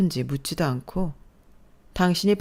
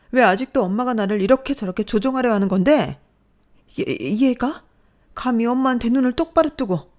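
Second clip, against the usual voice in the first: trembling with rage, higher and louder; angry outburst; 6 semitones higher, 5 dB louder than usual. Acted. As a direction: finally explode at mother. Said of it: "부들부들 떨며 화를 내는".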